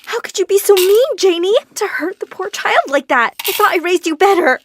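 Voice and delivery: high, loud furious mother; mother yelling at her daughter for the first time; mom yelling. Acted. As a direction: scold her daughter hard. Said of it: "she yelled at me".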